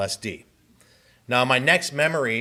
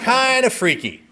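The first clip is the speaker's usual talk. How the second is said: laughing; 12 semitones higher, 6 dB louder than usual. Real, not acted. Laughing at his own story.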